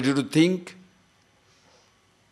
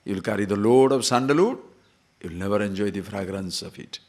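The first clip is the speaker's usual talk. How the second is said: normal talking voice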